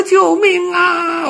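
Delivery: slow, very high, loud cry for help; terrified scream. A narrator's reading. Little dog fallen into mud pit, screaming in terror. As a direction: wail for rescue at full pitch. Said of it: "吓得大叫".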